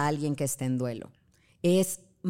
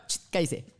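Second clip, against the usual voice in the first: rude hush. Retold or imitated. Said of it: "dice mi hijo muy grosera".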